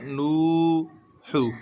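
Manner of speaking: नूहू with the oo held long; long madd vowel. Shown as a demonstration. Teacher showing one alif's length, नूहू held long. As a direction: draw out the oo in नूहू. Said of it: "एक अलिफ के बराबर".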